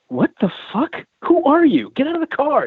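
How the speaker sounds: high-pitched startled challenge; alarmed, incredulous protest; retold outraged disbelief. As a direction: act out confronting an intruder, voice shooting up. Retold confrontation with stranger in car.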